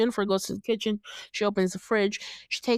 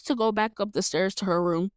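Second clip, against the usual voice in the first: usual speaking voice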